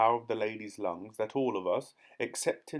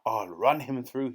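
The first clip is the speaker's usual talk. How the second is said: shout; 5 dB louder than usual. A narrator's reading. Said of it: "he shouted".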